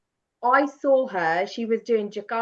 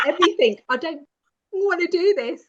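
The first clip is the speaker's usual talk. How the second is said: loud, high, worked-up refusal; defiant refusal; 7 semitones higher, 6 dB louder than usual. Retold or imitated. To her porter on the mountain, a loud high refusal. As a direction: shout back that you've given up.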